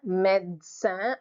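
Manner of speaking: médecin in two slow beats, med-sin; French médecin, split as med-sin; silent second E. Shown as a demonstration. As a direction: say médecin slowly, skipping the middle E. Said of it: "The second E is silent".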